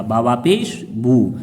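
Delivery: slow recitation, stretching बू; teacher's slow spelling chant; slow spelling drill. Shown as a demonstration. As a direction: draw बू out for the long vowel. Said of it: "वाव मद्दा कहलाएगा".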